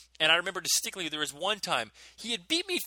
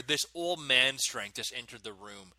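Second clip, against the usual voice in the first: ordinary talk, nothing marked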